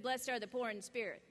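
low, hushed relay of the words; quiet repeat. Acted. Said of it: "He said".

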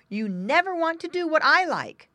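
loud, high-pitched sibling whine of protest; crying out crossly. A narrator's reading. Older pig scolding his brother, crying out crossly. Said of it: "cried the older pig boy".

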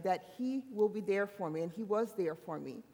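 ordinary delivery

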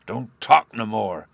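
slow, mildly growled rebuke. A narrator's reading. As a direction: growl it at the bungling orator. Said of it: "growled the man under the window".

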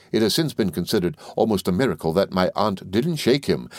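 plain reading voice

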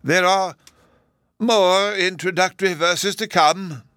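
high, loud, drawn-out professor; other professor, announcing. A narrator's reading.

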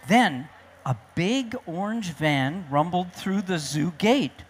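usual speaking voice

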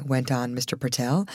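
usual speaking voice

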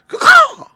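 very high, loud crow call; rascally crow caw. A narrator's reading. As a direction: screech out a crow's caw.

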